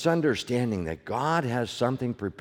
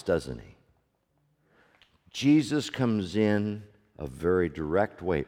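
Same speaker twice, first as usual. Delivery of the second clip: usual speaking voice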